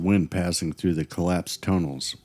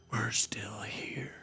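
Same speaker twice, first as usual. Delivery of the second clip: slow, hushed whisper from the dead; ghostly whisper; 14 dB quieter, slower than usual. Acted. Host imitating EVP voices in abandoned mines, ghostly whisper. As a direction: whisper it slowly like a spirit on a recording. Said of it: "where voices whisper".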